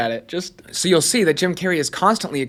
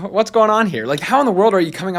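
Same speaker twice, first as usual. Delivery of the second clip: mock bewildered, pitched up and louder; baffled; 5 semitones higher, 3 dB louder than usual. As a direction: act stunned by the jumps. Bewildered by Robin's jumps.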